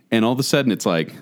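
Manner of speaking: ordinary talk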